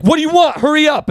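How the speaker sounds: loud, high-pitched imitation of a bossy server; impatient shop woman. Donut shop woman to a dithering customer, impatient. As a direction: mimic her rushing the customer along. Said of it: "everyone flustered".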